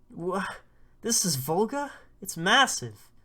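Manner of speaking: put-on boy stunned at the massive rhino; astonished. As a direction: gape at a giant beast. Boy sees huge rhino Volga.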